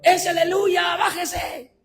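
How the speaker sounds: high-pitched mock yell; imitated heckler's shout. Bus passenger heckling the preacher, imitated shout. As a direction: call out like a jeering passenger. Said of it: "no sé quién me grita".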